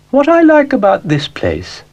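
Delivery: high, grand lady-cow voice; a fussy, genteel cow poking in; Ermyntrude the cow. Acted. Ermyntrude speaks.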